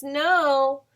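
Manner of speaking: plain reading voice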